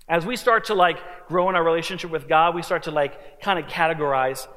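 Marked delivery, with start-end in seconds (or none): none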